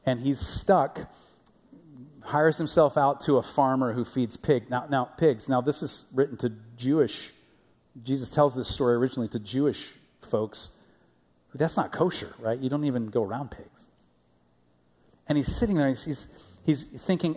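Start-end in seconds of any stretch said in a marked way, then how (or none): none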